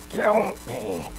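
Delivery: muted, choked cry from the throat; gurgling mock scream. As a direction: imitate a smothered, gurgled scream. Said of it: "he was gurgling and saying something".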